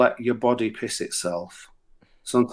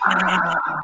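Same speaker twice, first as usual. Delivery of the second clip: higher, delighted crowd call; crowd cheering; 6 semitones higher, much slower than usual. Retold or imitated. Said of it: "this entire audience were like".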